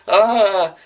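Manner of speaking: high, loud scared yelp; mock scared yelp. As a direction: yelp and scamper off. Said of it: "like I'm scared".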